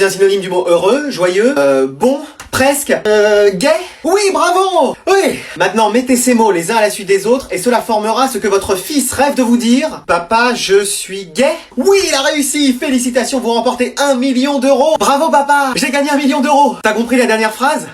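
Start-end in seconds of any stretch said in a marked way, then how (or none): 4.0-5.4 high, hammed-up host excitement; game-show cheer. Quiz host cheers correct answer. 11.8-15.7 excited host announcing a win; exuberant, raised-pitch congratulations; game-show celebration. Acted. Quiz host announces the big win. 15.8-16.8 higher-pitched cry of victory; acted elation, pitch lifted; excited winner. Contestant rejoices at the prize.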